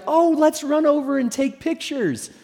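high-pitched, gushing excitement; eager tourists imitated.

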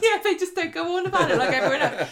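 high, loud words through laughter; laughing. Real, not acted. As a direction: crack up while agreeing. Joking along, laughing hard.

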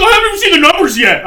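an agitated father blurting it out; mock panicked dad, high and quick; dad's outburst. Dad snapping at salesman.

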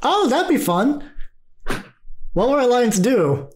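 high-pitched, eager character voice; high, curious and playful; eager, curious visitor. Acted. Plays visitor keen on alliance.

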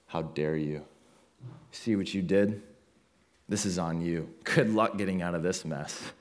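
low, quiet, cold contempt; cold harsh father. Retold or imitated. Father coldly blaming his bleeding daughter, low and icy. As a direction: turn your back on her with icy scorn. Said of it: "and he said".

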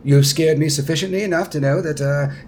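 building from measured to high, loud and fast; fussy prince, over-eager. Acted. Prince Darren insists the party will return, building fervour. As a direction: talk yourself into rousing conviction.

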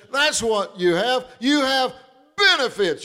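high, loud, joyful exclaiming; excited testimony. Retold or imitated. Doc standing up in church, exclaiming joyfully. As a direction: announce the good news with delight.

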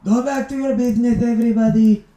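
loud, high, quick call to the crowd; shouting. Acted. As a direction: shout it out to move people along. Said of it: "just shouts out".

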